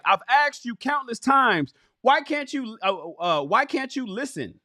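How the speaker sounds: high-pitched exasperated pleading; defending feelings. Acted. Man protesting to his partner who won't listen, high and defensive. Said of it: "defending your feelings by saying something like".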